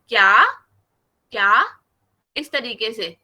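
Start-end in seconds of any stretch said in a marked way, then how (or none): 0.1-1.8 क्या rising like a puzzled question; क्या said like a surprised Urdu question. Shown as a demonstration. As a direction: ask क्या with a climbing pitch. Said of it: "जैसे हम उर्दू में कहते हैं ना".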